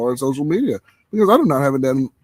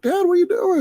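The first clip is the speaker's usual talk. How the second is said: put-on much higher, a kid's surprised question; imitating his young son; 4 dB louder than usual. Son finds his dad selling the toys, high surprised kid voice. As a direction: play the boy catching dad at the yard sale. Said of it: "He's like".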